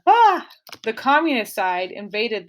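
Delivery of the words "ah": short high yelp of mock alarm; a teacher's playful shriek at the war news; mock scream. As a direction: squeal it like a cartoon fright.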